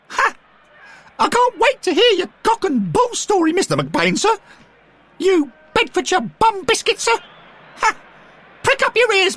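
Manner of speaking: very high, loud mocking boy; taunting young Derek. A narrator's reading. Derek jeers at McBain, very high and loud. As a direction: jeer gleefully.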